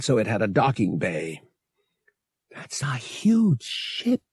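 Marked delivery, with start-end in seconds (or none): 2.5-4.2 slow, awed whisper. A narrator's reading. Woman staring at the huge ship, whispering. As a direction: whisper it slowly. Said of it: "Bria whispered".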